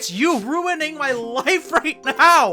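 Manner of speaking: high, strained, drawn-out cursing; gamer rage. Real, not acted. Player says game is ruining his life, high strained rage. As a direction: stretch the curses out in exasperation.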